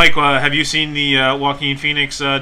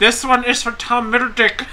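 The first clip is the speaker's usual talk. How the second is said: higher, laughing goofy voice; silly put-on voice; 8 semitones higher than usual. Podcaster pretends to address a celebrity in a goofy voice. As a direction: announce it like a clueless fan. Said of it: "it's a different voice".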